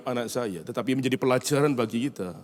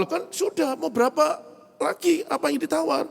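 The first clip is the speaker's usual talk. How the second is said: much higher, incredulous asking; father losing patience with his son; exasperated father. Retold or imitated. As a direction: sound fed up but amused.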